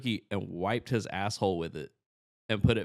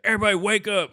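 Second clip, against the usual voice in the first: high, loud holler; scary boss's wake-up call; 10 semitones higher, 7 dB louder, faster than usual. Acted. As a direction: shout the whole room awake. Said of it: "the biggest, scariest guy would be like".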